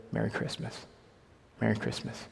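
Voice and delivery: soft, earnest whispered greeting; sincere whisper. Retold or imitated. Greeting the airport screening lady, a sincere whisper. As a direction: hush it, meaning every word. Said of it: "in the most sincere way".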